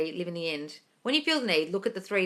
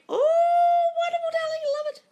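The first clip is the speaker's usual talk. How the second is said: very high, loud squeal of joy; delighted squeal; 6 dB louder than usual. Real, not acted. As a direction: gush like hearing a friend's big news. Delighted reaction to viewer meeting SP.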